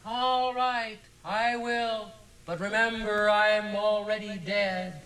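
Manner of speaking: high, slow ghostly wail; sobbing wail. A narrator's reading. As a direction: moan it like a weeping ghost, stretching the end. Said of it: "answered in a sobbing wail".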